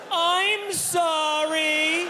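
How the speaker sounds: very high, drawn-out slow apology; yelled apology. Retold or imitated. Apologizing to the doctor, mock yelled high and slow. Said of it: "So I yelled".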